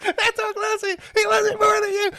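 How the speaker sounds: high, lightly voiced taunt; drunk girlfriend taunting. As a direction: tease in her high voice.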